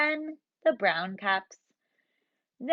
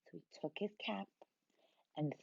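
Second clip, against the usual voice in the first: hushed; 6 semitones lower, 15 dB quieter, much faster than usual. A narrator's reading. Dramatic hushed narration.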